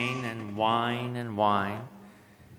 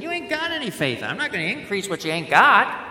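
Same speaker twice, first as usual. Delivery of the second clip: teasing Jesus voice; 9 semitones higher, 5 dB louder, faster than usual. Retold or imitated. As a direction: rib them with a grin.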